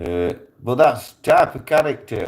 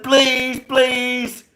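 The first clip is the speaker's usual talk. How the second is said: high, loud echo of their begging; mock pleading; 4 dB louder than usual. Gunman answering the begging crew, mock pleading. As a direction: throw their pleas back at them. Teller throws pleas back at them.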